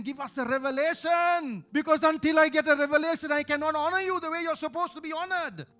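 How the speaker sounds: loud, high pleading; fervent preaching. Real, not acted. Preacher to congregation, loud high pleading. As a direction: cry out for revelation.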